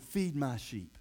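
low, soft voice of Jesus; calm command. Acted. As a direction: answer the outburst softly and simply. Jesus answers Peter's impassioned cry.

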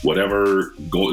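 ordinary talk, nothing marked

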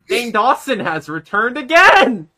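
high, loud fake cheer; mock excitement. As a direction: announce it with sarcastic glee.

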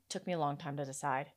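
ordinary talk, nothing marked

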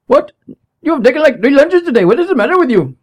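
high, loud mock yell of an exasperated boss; imitating boss yelling. Boss yelling at a worker over three lunches, high mock yell. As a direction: scold the worker like a fed-up boss. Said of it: "He would yell".

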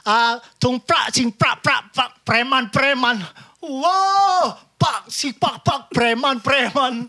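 fake Thai gibberish.

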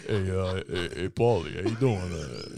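low mumbling Rocky impression; lightly voiced Italian tough guy; Stallone voice.

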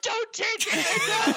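drawn-out, high mock wail; breaking down. Kid begging cops who threaten downtown, breaking down. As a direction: plead loudly in imitated hysterics. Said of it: "I broke down so bad".

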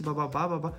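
normal talking voice

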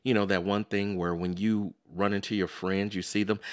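ordinary talk

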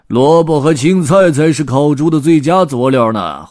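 loud, high character voice; grey-bearded hobo butting in loudly; opinionated tramp. A narrator's reading. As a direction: argue a recipe at full volume. Tramps argue over pig recipe.

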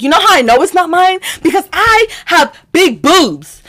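high, emphatic self-defence; indignant argument. Retold or imitated. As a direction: make the case high, slowing on the punchline. Retold confrontation of housemates.

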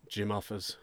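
ordinary delivery, nothing marked

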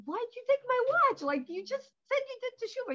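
high, loud hammed-up indignation; indignant rabbi. Rabbi confronting the thief, high loud mock outrage. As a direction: demand an answer, outraged but playful.

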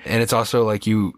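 ordinary delivery

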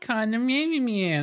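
cartoon gangster moll whine; Harley Quinn voice. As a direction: pitch way up like the cartoon character. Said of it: "that Harley Quinn voice".

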